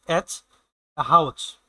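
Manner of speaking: হাউচ said low and firm, ending in a ch sound; German letter demo. Shown as a demonstration. Teacher shows students how German Haus sounds. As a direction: spell out এচ, then say হাউচ with a hard final ch. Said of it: "এস এর উচ্চারণটা নিয়ে যদি আমি বলি".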